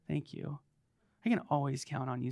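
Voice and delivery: ordinary talk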